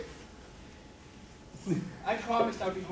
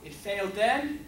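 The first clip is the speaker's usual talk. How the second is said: ordinary talk, nothing marked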